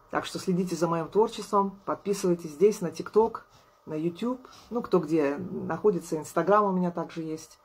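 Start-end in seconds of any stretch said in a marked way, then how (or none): none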